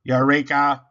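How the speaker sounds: loud, booming robot cry; Robot yelling. Acted. Robot finding nuts in Rabbit's table, loud robot yell. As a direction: boom it out like a machine finding treasure. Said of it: "Robot yelled".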